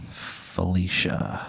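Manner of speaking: very slow, soft drawn-out name; ghostly introduction. Acted. Ghost introducing herself to Patty, slow and eerie. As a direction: stretch the name out quietly.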